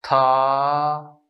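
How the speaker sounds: level drawn-out first tone on था. Shown as a demonstration. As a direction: stretch था out on one steady pitch. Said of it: "इसलिए इसको पढ़ा जाएगा".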